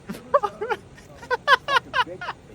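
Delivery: high, loud burst of laughing; long high laugh; amused laughter. Real, not acted. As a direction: crack up at what was just heard. Laughs at the man's insult.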